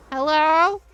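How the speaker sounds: loud high hello; calling out. Real, not acted. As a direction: call out to find him. Calling out for neighbor.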